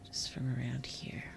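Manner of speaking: breathy whisper to herself. Real, not acted. Whispering while planning where to chop trees. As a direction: whisper it as a quiet aside.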